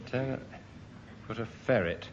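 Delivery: ordinary talk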